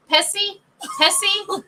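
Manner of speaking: put-on the kids parroting the waiter's Pepsi up high; kids imitating accent. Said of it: "they're like".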